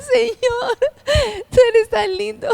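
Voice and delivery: high, tearful crying prayer. Retold or imitated. Wife hearing the pastor's call, crying to God. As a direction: voice breaking with tears of joy. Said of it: "Yo lloraba".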